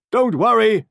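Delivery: high, loud farmer's call; farmer's cry. A narrator's reading. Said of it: "cried the farmer".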